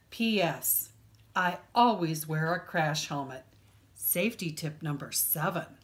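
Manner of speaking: ordinary delivery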